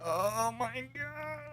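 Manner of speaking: very high, soft, drawn-out squeak; exasperated thin squeal. Pretend. Podcaster can't do this anymore, high faint whine. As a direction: stretch it out as a tiny high whine. Breaking down over stupid tweets.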